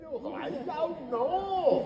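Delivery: hello, I don't know sung high and slow in a Welsh lilt; lilting Welsh elf take. Shown as a demonstration. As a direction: stretch it out in a lilting Welsh tune. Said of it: "scenes where we're going".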